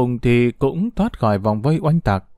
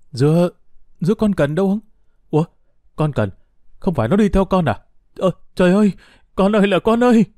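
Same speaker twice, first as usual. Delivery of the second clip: panicked dismay; 6 semitones higher than usual. A narrator's reading. Mother learns her child is missing, panicked dismay. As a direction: gasp out the questions, then cry out for the child. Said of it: "chị thảng thốt".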